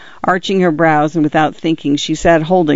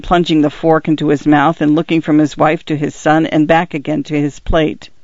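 ordinary delivery

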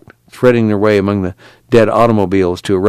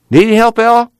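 calling out; 13 semitones higher, 5 dB louder than usual. Acted. Calling to Al under car.

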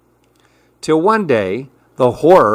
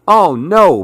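dismayed cry; 6 semitones higher, 3 dB louder than usual. Acted. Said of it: "Billy cried".

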